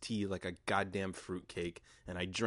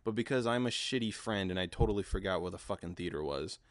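ordinary talk, nothing marked

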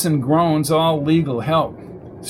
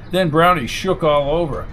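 usual speaking voice

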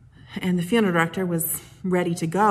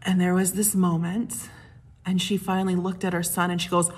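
ordinary talk, nothing marked

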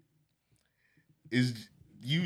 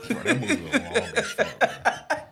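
laughing; 14 semitones higher than usual. Real, not acted. Laughs while complaining about length.

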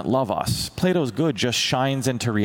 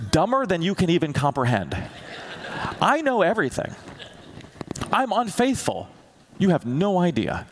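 God answering, rising; 6 semitones higher than usual. Retold or imitated.

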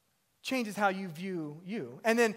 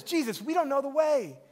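higher-pitched acted plea; raised pitch, lightly dramatised objection; mild protest; 7 semitones higher, 3 dB louder than usual. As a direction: voice a puzzled disciple, pitched up. Acting out Thomas questioning Jesus.

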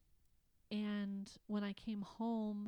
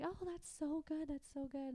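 higher-pitched retold gushing; delighted friend; 7 semitones higher than usual. Friend's delighted reply voiced.

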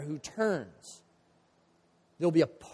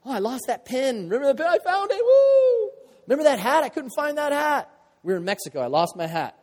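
much higher and louder gleeful reliving; excited; 8 semitones higher, 7 dB louder than usual. Pretend. Preacher tells the congregation about finding his hat, gleeful. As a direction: squeal over finding the lost hat.

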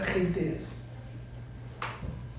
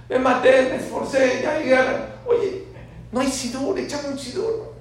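much higher, louder begging; pleading to God; 10 semitones higher, 7 dB louder than usual. Acted. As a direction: plead with God for a prayer book. Pilgrim begs God for a siddur.

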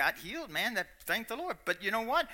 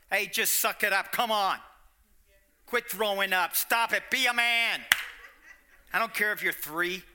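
a louder, scolding parent barking orders; mock tough-love father snapping at his sick child; mock harsh father; 2 semitones higher, 6 dB louder than usual. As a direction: boss the sick toddler around like a drill sergeant. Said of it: "no father should talk like that".

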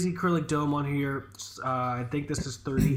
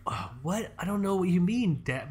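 mock-innocent puzzlement, lilting higher; feigned cluelessness; 4 semitones higher, 3 dB quieter than usual. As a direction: play dumb to dad. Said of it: "But, like, in my head, I'm like, I'm fully aware".

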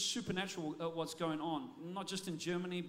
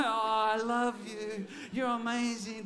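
slow, louder gushing lover's voice; mock smitten lover; 4 dB louder, slower than usual. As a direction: gush like a besotted boyfriend, hammed up. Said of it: "wooing the woman they love".

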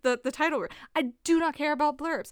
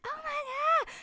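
mock gushing fan; slower than usual. Readers gushing in comments.

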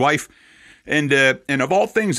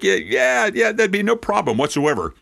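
retold eager yes, pitched higher; eager agreement; 8 semitones higher than usual. Rabbi voicing Jonathan Cahn agreeing eagerly, pitched up. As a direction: imitate his quick, happy yes.

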